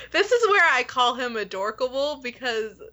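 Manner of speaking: usual speaking voice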